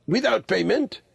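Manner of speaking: put-on high-pitched old lama's query; old lama, questioning. A narrator's reading. Old lama checking with Kim, high questioning voice. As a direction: ask it high, as an innocent old traveller.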